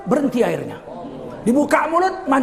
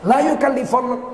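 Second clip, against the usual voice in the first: usual speaking voice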